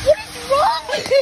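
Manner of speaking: high loud startled yell; startled shout. Real, not acted. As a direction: shout it in shock. Startled by vacuum prank.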